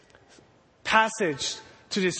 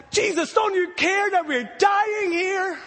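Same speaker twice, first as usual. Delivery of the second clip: desperate shout; 10 semitones higher than usual. Retold or imitated. Disciples shouting to wake Jesus in desperation. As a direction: cry out desperately for help.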